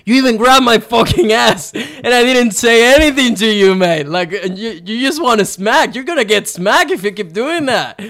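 high, loud furious shouting; shouting down the Brazilian surfer; yelling angrily. Retold or imitated. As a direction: rage at him for grabbing you. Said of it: "I'm still yelling shit at him".

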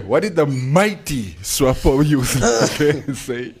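giggling through the question; laughing, can't keep a straight face; breaking character giggling. Real, not acted. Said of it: "I can't do this with a straight face".

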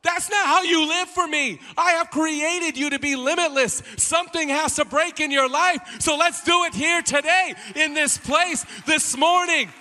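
loud, high preacher's shout as God; screaming. Acted. God objecting to limited living, screaming. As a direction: cry it out at full force, driving every phrase. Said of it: "God in heaven is screaming".

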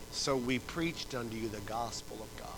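ordinary talk

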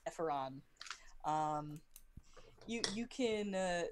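ordinary talk, nothing marked

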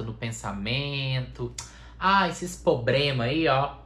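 ordinary talk

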